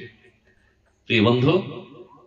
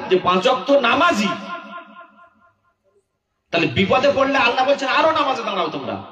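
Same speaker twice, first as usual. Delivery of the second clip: high, pressing sermon delivery; impassioned preaching; 9 semitones higher than usual. Real, not acted. Preacher rebuking worshippers who skip prayer in trouble, impassioned preaching. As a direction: drive it high, then fall away at the end.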